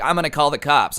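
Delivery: plain reading voice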